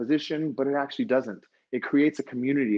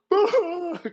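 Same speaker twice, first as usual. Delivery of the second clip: high, loud imitated wail; hammed-up crying sound, high and drawn out; mock bawling; 4 dB louder than usual. Teacher retells crying over the student's moment, mock wail. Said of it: "crying my eyeballs out".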